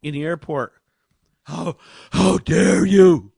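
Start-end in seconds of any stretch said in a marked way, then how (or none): 1.4-3.3 mock outrage. Mocking outrage of mask shamers.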